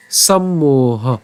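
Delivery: সম্মোহ drawn out slowly; syllable demo. Shown as a demonstration. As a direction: close "sam" on the m, then a long "moha" in সম্মোহ. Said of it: "প্রথমে একটা ম উচ্চারণ করলাম".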